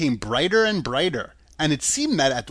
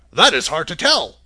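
thoughtful; 6 dB louder than usual. A narrator's reading. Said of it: "said the man thoughtfully".

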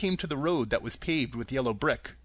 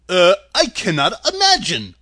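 Scarecrow voice; 3 semitones higher, 10 dB louder than usual. Acted.

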